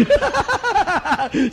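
big high-pitched laugh; bursting into a high laugh. Real, not acted. Laughing at wife's remark.

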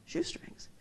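unvoiced, puzzled whisper; a stunned whisper of the odd answer; whispered echo. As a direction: breathe the word back in disbelief. Speaker echoes in disbelief.

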